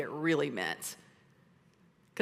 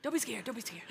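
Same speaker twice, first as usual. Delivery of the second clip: soothing angel; 5 semitones higher, 7 dB quieter, faster than usual. Retold or imitated. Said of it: "and he's like".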